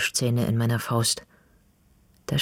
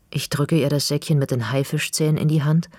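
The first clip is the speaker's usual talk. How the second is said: plain reading voice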